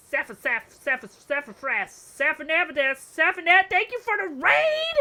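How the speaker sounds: ramping up into a squealy raid thank-you; rising excitement. Real, not acted. Streamer building up to thanking the raider, excited.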